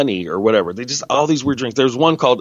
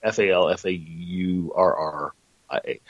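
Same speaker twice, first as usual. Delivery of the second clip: spelling out; 4 semitones lower, 5 dB quieter than usual. Shown as a demonstration. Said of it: "That's the town".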